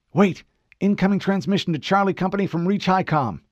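Kelly catching the transmission, alarmed; sudden alert. Acted. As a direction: stop him mid-word with news.

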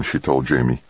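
ordinary delivery

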